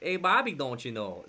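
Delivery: put-on singsong Minnesota accent calling to her boy; Minnesota mom. Cartoon mom calling Bobby, playful Minnesota accent. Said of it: "his mom was Minnesota, and she was always like".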